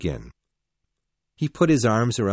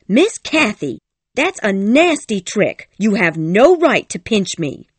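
angry shout; 10 semitones higher, 8 dB louder than usual. A narrator's reading. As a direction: shout back in pain and outrage. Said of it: "Ellen shouted angrily".

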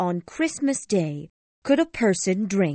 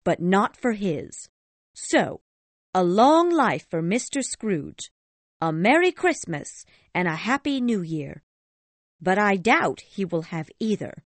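higher, mock-cheerful wishes with a sour edge; sarcastic toast; 10 semitones higher, 3 dB louder than usual. A narrator's reading. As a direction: toast him without meaning it.

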